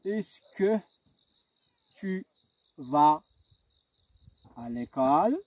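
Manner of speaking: est-ce que tu vas à l'école? ending with a rise on l'école; question rise. Shown as a demonstration. Teacher models the est-ce que question, rising. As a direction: let l'école climb at the end. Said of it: "je change l'intonation".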